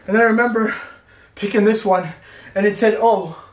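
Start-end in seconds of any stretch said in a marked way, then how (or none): none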